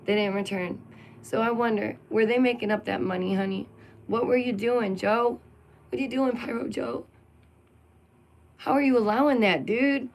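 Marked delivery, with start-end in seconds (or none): 4.1-10.1 indignant call-out. Real, not acted. Vlogger confronting Pyro Joe over the fees, indignant. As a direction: press him for answers.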